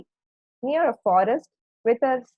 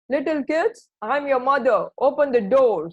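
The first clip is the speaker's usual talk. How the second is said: louder, raised wolf call; wolf posing as mother; 5 semitones higher, 6 dB louder than usual. A narrator's reading. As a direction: call out loudly through the door as the wolf. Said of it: "said the wolf".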